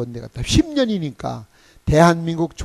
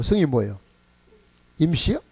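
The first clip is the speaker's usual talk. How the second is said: normal talking voice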